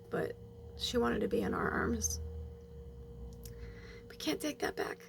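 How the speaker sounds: fighting tears while telling it; holding back tears. Real, not acted. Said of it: "I'm trying not to cry right now".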